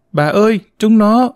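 high, whimpering child's cry, acted; tearful little girl. A narrator's reading. Little girl runs in to tell grandmother, tearful. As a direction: call out like a child near tears. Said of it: "Cô Tí khóc mếu chạy vào".

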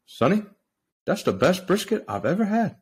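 high-pitched attempt at a Welsh accent, part old man; botched Welsh accent. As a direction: imitate a Welsh guest, badly, pitched well up. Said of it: "went from old man to Welsh real fast".

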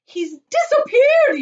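high, loud, drawn-out wail; panicked yell. A narrator's reading. Mother loses sight of son, yelling. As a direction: yell it in horror. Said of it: "yelled Missus Gloop".

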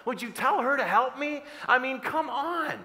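acted-out fed-up plea; exasperated complaint. Voices Martha's exasperated complaint.

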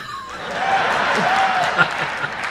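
breathy drawn-out chuckle; awkward chuckle. Real, not acted. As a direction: laugh off the tension. Awkward laugh.

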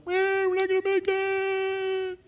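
high, slow, whiny mock voice; preacher mocking the grumbling Israelites, high and whiny. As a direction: whine it like a defeated complainer.

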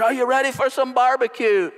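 high, excited game-day shout; hype announcer call. Retold or imitated. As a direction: pump up the crowd like a stadium announcer. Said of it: "I was gonna run out and say".

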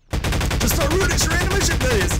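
high, loud panicked plea; yelled desperate request; frantic pleading. Real, not acted. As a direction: beg under pressure. Pleading with game during chase.

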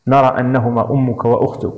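usual speaking voice

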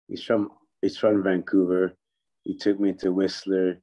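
normal talking voice

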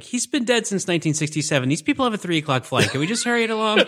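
an impatient, put-upon guest manager; exasperated Fox impression. Fox impatiently pushing the bellhop to hurry, exasperated.

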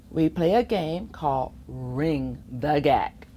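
ordinary delivery, nothing marked

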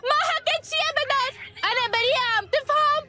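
frantic and tearful, near breaking; crying protest of innocence; hysterical crying denial. Acted. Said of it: "اهدي بقى".

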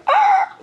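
very high loud yelp; dog yelp. Retold or imitated. Breeder imitating the puppy's yelp, very high and loud. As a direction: squeal like a pinched puppy. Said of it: "and they go".

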